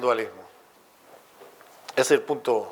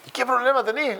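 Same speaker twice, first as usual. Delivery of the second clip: much higher, cartoonish question; high mock Aristotle; 12 semitones higher than usual. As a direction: ask him in a squeaky put-on voice. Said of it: "le dice Aristóteles".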